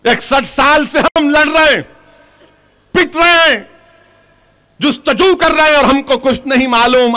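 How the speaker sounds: high-pitched protest to a crowd; impassioned political retort; indignant oratory. Real, not acted. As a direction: hurl the grievance out from the podium.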